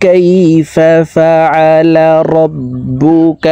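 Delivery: slow tajweed chant pitched above speech; Quran recitation. Shown as a demonstration. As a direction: recite the whole verse with long vowels.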